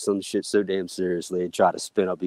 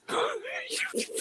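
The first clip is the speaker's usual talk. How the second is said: squealing laugh; 8 dB quieter than usual. Real, not acted.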